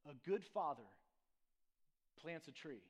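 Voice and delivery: lightly imitated African accent, low and measured; thick African accent. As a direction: retell it in an African pastor's accent, pausing between phrases. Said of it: "African accent, uh, real thick".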